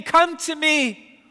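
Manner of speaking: loud, high pleading sermon; urgent altar call. Acted. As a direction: plead with the congregation to come.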